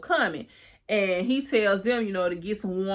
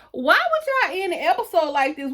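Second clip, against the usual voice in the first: much higher, incredulous rant; exasperated complaint; 8 semitones higher than usual. Real, not acted. Viewer complaining the episode ended there, exasperated and much higher. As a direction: protest at the show like you can't believe it. Ranting about the episode ending.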